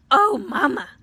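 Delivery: put-on high, loud joyful child; delighted tiny voice; high happy squeal; happy little cry. A narrator's reading. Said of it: "Eulalie said happily".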